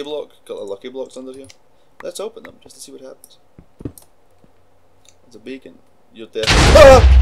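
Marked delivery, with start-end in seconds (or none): 6.5-7.2 high loud cry of defeat; pained scream. Real, not acted. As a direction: scream as you get killed. Dying in game.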